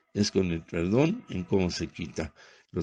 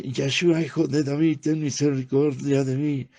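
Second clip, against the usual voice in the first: crying out; 5 semitones higher than usual. Retold or imitated. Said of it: "él gritaba".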